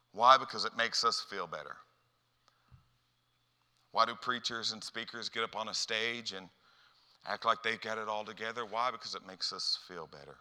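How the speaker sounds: normal talking voice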